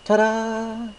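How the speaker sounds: showman's ta-da. A narrator's reading. Baker shows off returning the bag, theatrical flourish. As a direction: present it like a magic trick. Mister Cake presents the bag.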